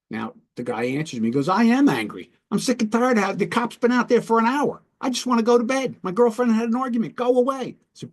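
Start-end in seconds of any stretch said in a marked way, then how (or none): 1.5-7.8 high-pitched fed-up venting; acted exasperated outburst, pitched way up; angry. Guy answers the negotiator at his door, angry venting. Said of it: "you sound really angry".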